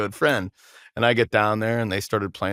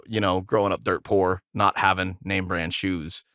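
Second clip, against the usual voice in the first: ordinary talk, nothing marked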